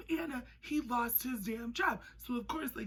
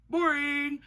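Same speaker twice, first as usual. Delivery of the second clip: loud scoffing; 7 semitones higher, 8 dB louder than usual. Real, not acted. Judging vanilla relationship.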